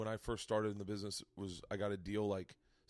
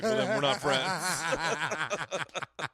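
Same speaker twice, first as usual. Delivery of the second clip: slow, louder, laughing through it; laughing quote; 4 semitones higher, 6 dB louder, much slower than usual. Retold or imitated. Retelling Patrice's friendship rule, slow laughing. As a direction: drag it out cracking up. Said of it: "he was like".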